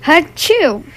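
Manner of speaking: loud high sneeze; a child's hammed-up bear sneeze; big sneeze. A narrator's reading. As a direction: let out a bear's sneeze. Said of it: "makes the bear".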